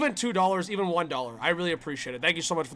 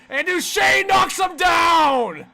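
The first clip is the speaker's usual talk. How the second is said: higher, drawn-out shout on the hit; excited hockey commentator yell; excited play call; 10 semitones higher, 5 dB louder, slower than usual. Real, not acted. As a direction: punch up the knockdown, stretching it out. Calling a big hit live.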